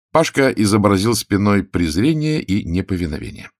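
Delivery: usual speaking voice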